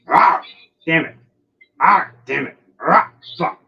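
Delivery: high, loud exasperated curses; frustrated cursing. Real, not acted. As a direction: curse the missed bite. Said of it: "snip at him and miss".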